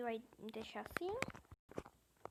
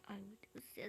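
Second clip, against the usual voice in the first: whisper; 5 semitones lower, 8 dB quieter than usual. Real, not acted. Afraid of being reported.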